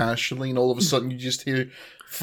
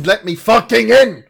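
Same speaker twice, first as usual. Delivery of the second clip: barking; 8 semitones higher, 10 dB louder than usual. Pretend. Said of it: "barking at the fucking doorman going".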